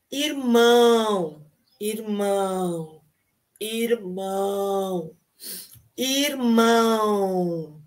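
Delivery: irmão drawn out slowly with nasal ão; slow repeated irmão drill. Shown as a demonstration. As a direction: stretch out the ão of irmão. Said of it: "A palavra irmão termina com a letra O".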